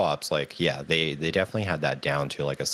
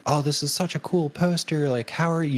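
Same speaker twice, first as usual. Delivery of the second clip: much higher gushing mock voice; imitating Frodo actor; 11 semitones higher than usual. Fan mimics Elijah admiring her poster in a high gushing voice. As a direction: gush high about the poster like an excited fan. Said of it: "He was like".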